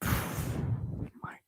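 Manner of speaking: breathy unvoiced whisper; a sudden whispered aside. Real, not acted. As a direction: drop to a hushed whisper.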